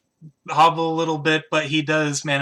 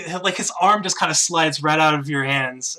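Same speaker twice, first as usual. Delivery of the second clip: ordinary delivery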